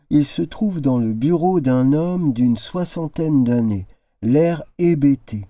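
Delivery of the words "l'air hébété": usual speaking voice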